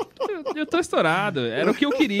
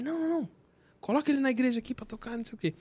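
higher, softer imitation of brisk urging; insistent pastor; 7 semitones higher, 6 dB quieter, much faster than usual. Pastor telling his son to let the guitarist play, insistent. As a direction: lightly act out waving objections away.

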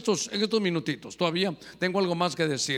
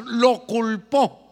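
slow, louder punch on lo culpó; forceful; 2 semitones higher, 6 dB louder, much slower than usual. Shown as a demonstration. Preacher showing how Jesus blamed him, slow and loud. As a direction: roar it out like a charge. Preacher explaining rugir, indignado.

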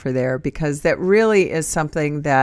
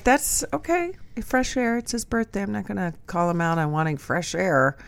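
mock understanding; 2 semitones higher, 9 dB quieter than usual. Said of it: "I'm like".

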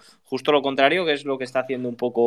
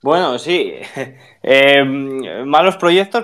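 ordinary delivery

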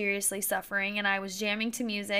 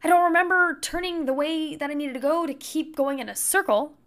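retold baffled disbelief, higher; confused what-is-happening exclamation; surprised; 6 semitones higher, 4 dB louder than usual. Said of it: "I remember being like".

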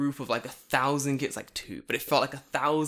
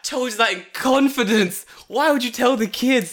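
high, loud betrayed protest; mock fury with laughter creeping in; comic outrage; 10 semitones higher, 7 dB louder than usual. Teacher scolding colleague for telling kids, loud mock outrage.

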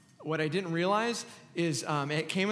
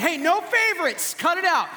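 mock scolding; 10 semitones higher, 4 dB louder than usual. Playful mock scolding of audience.

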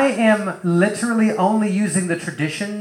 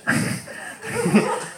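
a soft airless laugh at his own joke; breathy real laugh; 3 semitones higher, 4 dB quieter than usual. Real, not acted. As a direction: let a quiet laugh slip out.

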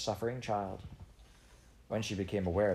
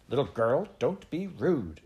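slow, high, loud rebuke; indignant rebuke; 5 semitones higher, 5 dB louder, slower than usual. A narrator's reading. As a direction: flush with offence as you scold. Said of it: "flushing violet".

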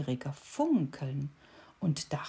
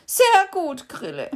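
much higher, loud wailing beetle; howled; 12 semitones higher, 11 dB louder than usual. A narrator's reading. Knocked-over beetle howling at the cricket. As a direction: wail it out high. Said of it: "heulte er".

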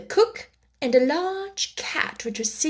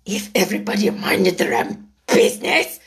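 low, rough growling duchess; hoarse growl; 3 semitones lower than usual. A narrator's reading. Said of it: "said in a hoarse growl".

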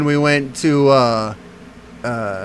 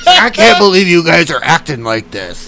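mock angry bartender; 3 semitones higher, 5 dB louder than usual. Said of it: "the bartender was straight up pissed".

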